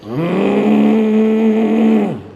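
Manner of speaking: long loud nasal drone like snoring; loud snore. Retold or imitated. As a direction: imitate a snore. Imitating loud snoring sound. (meniru bunyi dengkur kuat)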